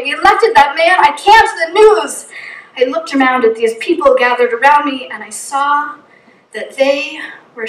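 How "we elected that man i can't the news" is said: tearful outburst. Retold or imitated. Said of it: "Through tears and convulsions".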